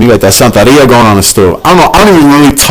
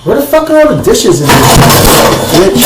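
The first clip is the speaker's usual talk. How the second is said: high, exasperated cry; exasperated yell; 6 semitones higher than usual. Real, not acted. Can't find the dishes, calling out high and exasperated. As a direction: demand it of the whole kitchen.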